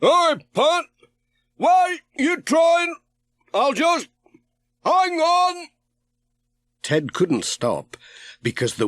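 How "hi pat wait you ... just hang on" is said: much higher, distant calling voice; Ted calling out. A narrator's reading. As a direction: shout it across the water from a passing boat. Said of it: "It sounded like".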